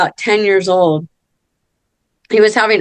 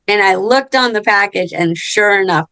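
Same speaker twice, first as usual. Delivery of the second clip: ordinary talk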